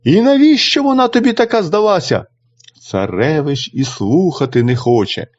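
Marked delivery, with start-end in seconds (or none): 0.0-2.2 a higher, sneering mother's reproach; the mother nagging her son once more, higher; scornful mother. A narrator's reading. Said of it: "мати знову до нього". (мати докоряє синові, вище й зневажливо)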